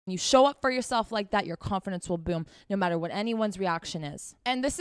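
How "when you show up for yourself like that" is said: ordinary delivery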